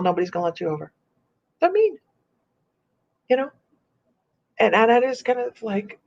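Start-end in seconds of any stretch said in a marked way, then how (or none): none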